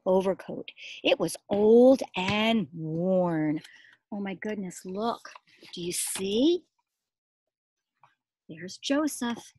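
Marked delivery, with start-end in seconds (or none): none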